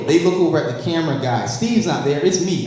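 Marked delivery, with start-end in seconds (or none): none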